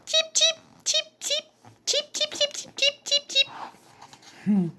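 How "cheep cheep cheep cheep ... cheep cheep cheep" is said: very high tiny chirps; baby chick cheeps. Acted. Baby chicks cheeping on the head, high chirps. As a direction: peep like newborn chicks.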